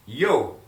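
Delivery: Spanish yo said clearly and loud for repeating; model pronunciation. Shown as a demonstration. Teacher modeling "Yo" for the class to repeat. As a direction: say "Yo" slowly so students can copy. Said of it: "I want you to repeat after me".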